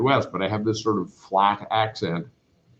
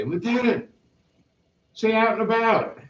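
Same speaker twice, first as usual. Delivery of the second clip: higher mock goading request; teasing new guys; 12 semitones higher than usual. New Navy guys goading the lieutenant, higher teasing voice. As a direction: egg the lieutenant on like a grinning recruit. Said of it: "and they'd say".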